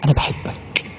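whispered softly, as if close; tender whisper. Acted. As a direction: breathe "أنا بحبك" gently. Repeating the Prophet's loving words. (همس حنون)

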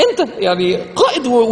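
normal talking voice

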